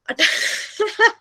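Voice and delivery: short, high, helpless giggle mid-lesson; real laugh. Real, not acted. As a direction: laugh out at your own ghost story. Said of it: "मुझे हंसी आ रही है".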